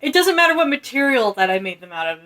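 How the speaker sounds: high, outraged sibling protest; hammed-up squabbling brother claiming credit; mock indignant retort. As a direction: protest hotly, stretching the key words. Acted sibling quarrel over the children.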